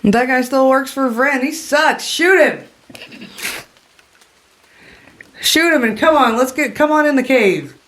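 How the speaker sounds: high, dismissive woman's voice; scornful orc woman. Acted. Woman in the cave urges stranger to shoot Talrin, high and scornful. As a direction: call out high, writing him off.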